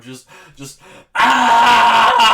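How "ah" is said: huge roaring battle yell, much higher and louder; war cry. Acted. Manny, horrified by the gore, roars a war cry. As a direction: let out a full-throated war cry. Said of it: "a war cry mode".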